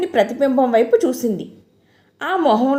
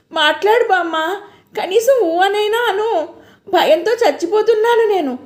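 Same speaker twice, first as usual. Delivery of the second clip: high, tearful pleading; crying plea; 6 semitones higher than usual. A narrator's reading. Jayanti pleading with grandma, high tearful voice. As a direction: beg her through rising tears. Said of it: "అంటూ ఏడవసాగింది".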